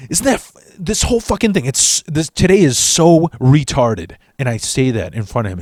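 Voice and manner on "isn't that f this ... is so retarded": frantic rant. Retold or imitated. Stressed rant in front of friend.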